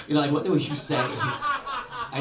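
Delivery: loud laughing aside. Real, not acted. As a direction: laugh it out to the crowd.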